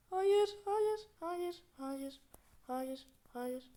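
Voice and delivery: high pitched frantic no; panicked cries of no; being chased. Real, not acted. Caught by the cooks.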